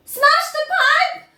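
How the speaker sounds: very high, loud, slow yell. Acted. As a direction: demand they smash it. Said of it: "yelled Missus Gloop".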